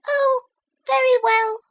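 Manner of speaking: a tiny shellfish squeak; very high thin little voice; thin sharp mussel voice. A narrator's reading. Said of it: "thin, sharp voices".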